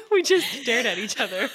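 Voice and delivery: laughing. Real, not acted. Laughing at the awkward silence.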